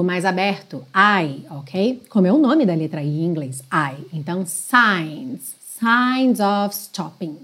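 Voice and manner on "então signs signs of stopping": "signs" slow with a long open I, no G. Shown as a demonstration. Teacher showing the silent G in "signs". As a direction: stretch the I in "signs". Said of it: "som do I mais longo".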